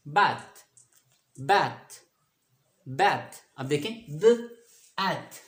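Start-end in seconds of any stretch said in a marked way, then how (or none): 0.1-3.4 बैथ said three times, crisp and even; बैथ repeated for the class; repeated drill word. Shown as a demonstration. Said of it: "इसको आप थ भी पढ़ सकते हैं". 4.2-5.4 द then एथ split apart; syllables pulled apart for students; sounded out. Shown as a demonstration. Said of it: "short बन रहा है".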